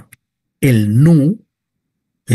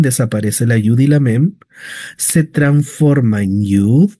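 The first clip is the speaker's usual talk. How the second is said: ordinary delivery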